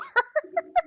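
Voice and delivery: a high, loud burst of laughter. Real, not acted. Player laughs after nod.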